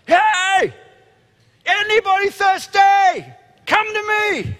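full high-pitched yell, long drawn-out words; shouting to a crowd. Acted. Jesus shouting over the packed festival crowd from a high place. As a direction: holler it at the top of your voice. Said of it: "he shouts to the crowd".

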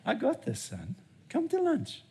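a father's easy I've-got-this; soft reassuring father. Acted. God reassuring his worried son, soft and high.

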